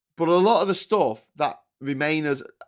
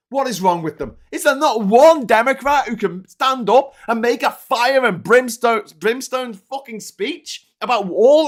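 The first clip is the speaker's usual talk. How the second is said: exasperated, loud, high-pitched rant; real shouting; 5 semitones higher, 7 dB louder than usual. Real, not acted. Streamer railing at spineless Democrats, real angry shouting. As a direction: rail at them with mounting indignation. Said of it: "I'm sorry for shouting".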